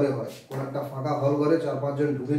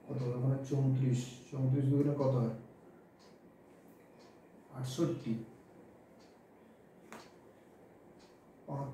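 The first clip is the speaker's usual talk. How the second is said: low, soft working-out voice; quiet self-talk; 4 semitones lower, 9 dB quieter than usual. Real, not acted. Teacher working out the multiplication to himself, low and quiet. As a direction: think the sums through under your breath, with pauses.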